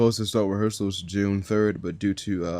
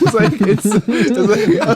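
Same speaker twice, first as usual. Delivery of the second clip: laughing; 13 semitones higher, 10 dB louder, slower than usual. Real, not acted. Laughing at own story.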